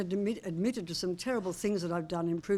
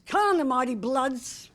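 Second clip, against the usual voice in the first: slow, high, loud supporter's cry; yelling team cheer; 6 semitones higher, 7 dB louder than usual. Real, not acted. Lifelong fan cheering on the Bloods, yelling. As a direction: yell it out like a lifelong footy fan. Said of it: "been yelling for sixty-seven years".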